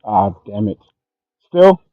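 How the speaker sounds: normal talking voice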